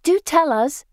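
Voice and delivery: high, loud excited plea; eager demand. A narrator's reading. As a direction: press eagerly for the story. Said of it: "they demand".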